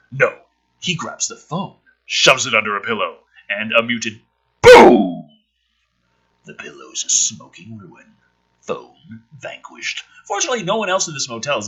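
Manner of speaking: low, subdued screenplay read-through; script reading. Acted. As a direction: read the scene in a low, dry voice. Reads the script aloud.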